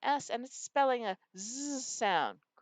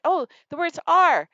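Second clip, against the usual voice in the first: student voice, higher and louder; imitating a student; 5 semitones higher, 10 dB louder than usual. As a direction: play an eager student answering. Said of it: "Or if they say it".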